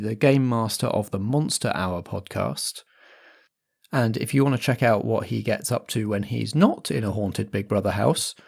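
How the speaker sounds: normal talking voice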